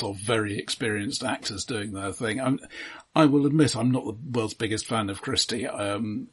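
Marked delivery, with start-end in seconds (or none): none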